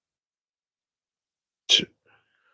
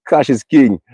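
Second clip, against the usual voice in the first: imitated Jamaican accent, pitched up; 5 semitones higher than usual. Quoting a guy he knew, in a Jamaican accent. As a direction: quote him in a put-on Jamaican accent. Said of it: "It's with an accent".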